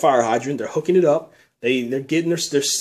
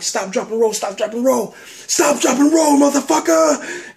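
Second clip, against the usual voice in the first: yelled higher and higher, louder at the end; frantic yelling; 9 semitones higher, 4 dB louder than usual. Retold or imitated. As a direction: scream the command more desperately each time. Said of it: "I'm like, I'm like".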